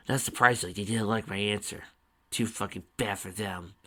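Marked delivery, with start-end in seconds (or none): none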